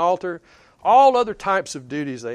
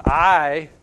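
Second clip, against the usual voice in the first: "I" set apart, louder, followed by a pause; stress on I; 5 dB louder than usual. Acted. Jesus referencing himself to his critics, weighty stress on "I". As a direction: land hard on "I" and let it hang. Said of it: "Jesus referenced himself".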